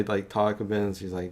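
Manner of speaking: ordinary delivery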